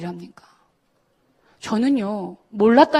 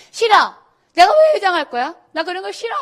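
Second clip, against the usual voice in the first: bratty refusal; 7 semitones higher than usual. Retold or imitated. Classmate refusing, voiced high.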